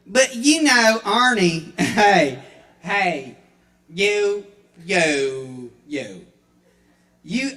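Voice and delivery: Paula Deen voice. Acted. A hint of Paula Deen brushing off Arnie. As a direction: sass him back like a stubborn cook.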